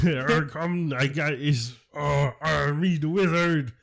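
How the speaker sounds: slow, hammed-up pirate accent; cartoon pirate accent. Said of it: "cartoon pirates".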